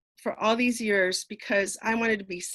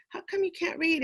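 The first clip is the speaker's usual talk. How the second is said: shocked question; 8 semitones higher than usual. Retold or imitated. Teacher shocked by students.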